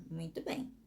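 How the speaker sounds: soft praise. Shown as a demonstration. Praising after the example.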